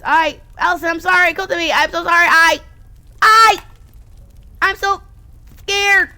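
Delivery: high, rising frightened pleading; scared apology. Acted. Saturday apologizing to Allison, frightened. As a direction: break down, scared.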